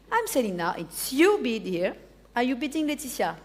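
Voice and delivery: usual speaking voice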